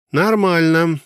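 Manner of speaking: put-on girl's voice. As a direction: answer brightly as the girl. Alice answers from the hull.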